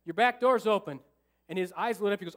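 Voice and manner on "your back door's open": loud call across lanes; retold shout to a truck driver; yelling. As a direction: shout it to the next car. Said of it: "I'm just yelling and screaming".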